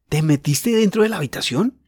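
put-on little girl eager to know; eager call. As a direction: press him to tell. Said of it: "gritó Ana".

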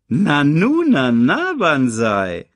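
loud, swooping villain voice; hyena. A narrator's reading. Hyena Shensi sniffs out intruders, menacing hyena voice. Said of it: "Eine stinkende Hyäne".

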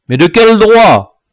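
high, loud challenge. A narrator's reading. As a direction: raise your voice in indignation. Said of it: "en haussant la voix".

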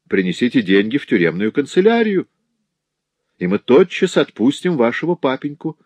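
lightly voiced bailiff, laying down his condition; bailiff setting terms. Пристав ставит условие. (голос пристава, повыше)

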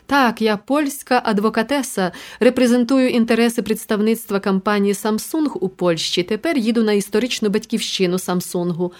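plain reading voice

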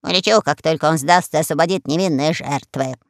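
low croaky parrot. Acted. Parrot to the queen, stubborn and low. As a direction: speak like a stubborn old pirate parrot.

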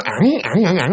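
imitated little dog whimpers; short repeated yelps like a nervous dog. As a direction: mimic an excited, scared dog. Imitates the frightened dog's whimper.

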